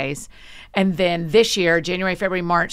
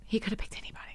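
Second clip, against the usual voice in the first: whispered; 4 semitones higher, 13 dB quieter, much faster than usual. Real, not acted. Repeated humble phrase.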